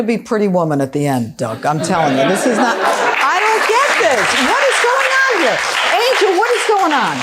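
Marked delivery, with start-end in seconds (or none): 2.8-7.2 exasperated bafflement. Real, not acted. Judge demanding answers from Angel, exasperated and baffled. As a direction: throw up your hands vocally, pitch soaring.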